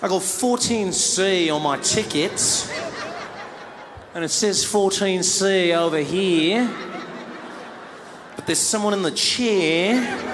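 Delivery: mock Australian puzzlement, drawn out slowly for everyone to hear; slow, loaded thinking aloud; pointed passive-aggressive. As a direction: stretch each phrase to make someone uncomfortable. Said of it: "try and speak loud and make you uncomfortable".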